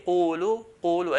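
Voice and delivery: "qulu" rounded and heavy, a step below "qala". Shown as a demonstration. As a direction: say "qulu" firmly with a rounded qaf. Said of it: "just below".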